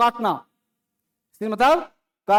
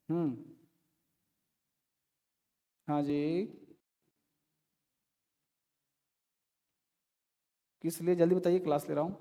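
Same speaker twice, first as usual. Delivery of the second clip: much softer, lower voice; hushed aside; 6 semitones lower, 12 dB quieter, faster than usual. Real, not acted. Teacher to a caller interrupting the class, hushed aside. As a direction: keep it down, a quick private interruption. Takes a side call during class.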